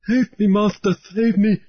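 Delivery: high, pleading yammer; high, desperate pleading; yammering native sailor. A narrator's reading. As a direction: beg the captain for rescue in a high voice. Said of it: "the man yammered in English".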